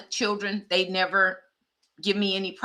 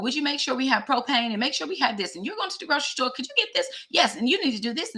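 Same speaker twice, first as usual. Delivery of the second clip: higher, sing-song list of requests; wife on the phone; 6 semitones higher, faster than usual. Retold or imitated. Friend phoning her husband with errands, wifely requests in a higher voice. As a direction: rattle off errands to a husband, pitch lifted.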